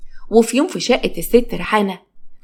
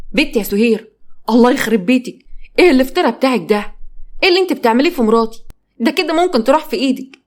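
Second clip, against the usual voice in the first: angry outburst; 4 semitones higher than usual. Acted. Husband scolding his sister for beating his wife, angry. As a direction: yell at your sister to stop.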